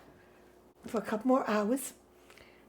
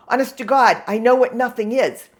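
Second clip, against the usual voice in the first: exasperated retort; 9 dB louder than usual. Retold or imitated. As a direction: insist at full voice, retelling your exasperation. Retold exasperated retort to repairman.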